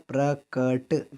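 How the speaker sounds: "प्रकट" stretched very slowly, syllable by syllable; "प्रकट" spoken very slowly and clearly, like a teacher giving the answer; drawn-out answer. Shown as a demonstration. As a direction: drag out "प्रकट" slowly so the class hears each sound. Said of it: "क्या होता है".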